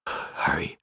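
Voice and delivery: low, voiceless whispered urging; imitated whisper. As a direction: mimic a kid whispering to hurry. Son urging dad, imitated.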